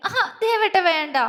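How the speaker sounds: high-pitched coy whine of protest; playful whining. A narrator's reading. Girl being tickled begs him to stop, whining. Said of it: "അവൾ ചിണുങ്ങി".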